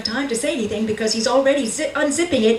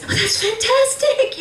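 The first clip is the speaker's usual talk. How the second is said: much higher, amazed and thrilled; stunned delight; 13 semitones higher than usual. Acted. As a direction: gasp it out in disbelief. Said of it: "You are stunned".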